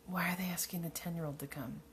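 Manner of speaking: incredulous whisper. Real, not acted. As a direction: whisper the question, baffled. Puzzled aside.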